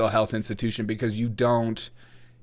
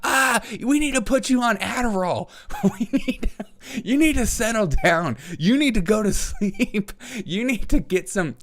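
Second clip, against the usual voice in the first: much higher mock scolding; 11 semitones higher than usual. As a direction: talk down to them like a hyper kid. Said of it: "we are just like".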